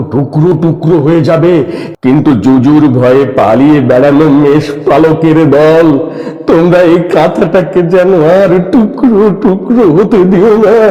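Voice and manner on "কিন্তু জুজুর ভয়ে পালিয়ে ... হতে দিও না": rousing stage plea. Acted. Climactic plea to the refugees.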